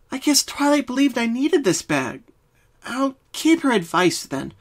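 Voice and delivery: high, lightly voiced, talking to herself; musing Pinky. A narrator's reading. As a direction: reason it out to herself. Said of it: "Pinky muttered to herself".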